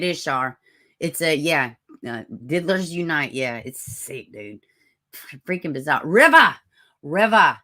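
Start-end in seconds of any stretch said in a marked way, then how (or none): 6.1-7.6 loud, excited name call. Real, not acted. Streamer greets an arriving viewer, calling out loudly.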